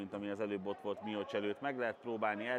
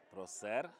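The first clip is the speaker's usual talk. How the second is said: ordinary talk, nothing marked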